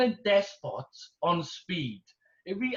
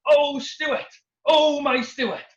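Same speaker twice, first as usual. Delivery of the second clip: higher and louder, slow adoring cry; rousing tribute; 6 semitones higher, 6 dB louder, much slower than usual. Retold or imitated. Students standing on desks for Stuart, louder and adoring. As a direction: cry out in adoration like a hammed-up film finale. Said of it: "jump up on the desk and go".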